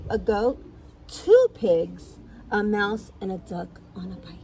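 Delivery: animated picture-book reading; lively storyteller with swooping pitch; story narration. A narrator's reading. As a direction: read it to small children, bouncy and clear. Reads a picture book aloud.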